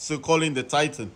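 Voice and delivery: normal talking voice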